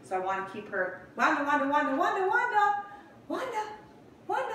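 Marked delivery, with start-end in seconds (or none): 1.2-4.5 rising high calls of her name; calling the puppy. Real, not acted. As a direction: call her higher and higher.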